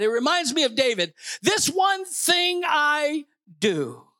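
impassioned preaching. Real, not acted. Pastor telling the church of Jabez's one thing, high and fervent. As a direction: build the sermon high, stretching the big phrases.

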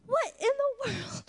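high-pitched, drawn-out retold bafflement; baffled disbelief. Speaker retells her reaction to swollen ankles, baffled disbelief.

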